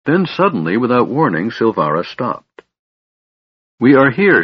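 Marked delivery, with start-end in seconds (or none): 3.8-4.4 louder, higher announcement; proclaiming the destination in the fog; announcing arrival. A narrator's reading. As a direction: call out that they've arrived. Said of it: "she said".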